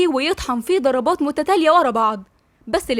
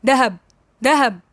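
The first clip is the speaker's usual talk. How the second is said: mildly raised calling voice; narrated call, only slightly raised; calling out loudly. A narrator's reading. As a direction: call for someone through the house, held back. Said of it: "جبل بصوت عالي".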